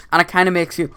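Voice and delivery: usual speaking voice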